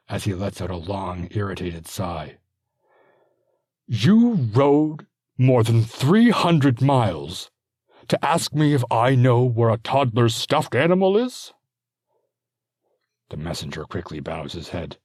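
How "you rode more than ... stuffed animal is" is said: slow, loud disbelieving exasperation; exasperated. Acted. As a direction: let the irritation drag out each word. Exasperated lord, irritated sigh.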